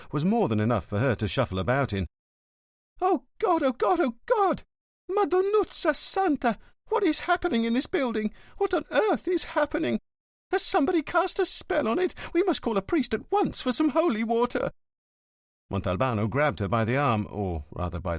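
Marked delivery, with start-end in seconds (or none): 3.0-14.7 much higher, wailing and theatrical; shrill-sounding hammed-up lament from the porter; hysterical old concierge. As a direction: carry on like a scandalised superstitious old woman. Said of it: "Cut out the theatrics".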